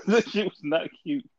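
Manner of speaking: high-pitched, laughed-out words; laughing. Real, not acted. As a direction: crack up while saying it. Laughing at own childhood struggle.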